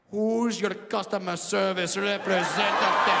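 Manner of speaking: slow, soft, mock-sultry Indian accent. Indian man whispers mock-sexy in exaggerated Indian accent. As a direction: purr it like a call-centre seduction. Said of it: "whisper something soft and sexy".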